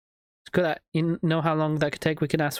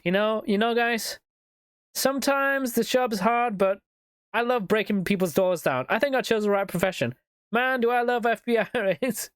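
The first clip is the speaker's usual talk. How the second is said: hammed-up happy FBI agent; Joe the FBI agent; 6 semitones higher than usual. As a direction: play a cop who adores his job. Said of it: "there's just some guy called Joe".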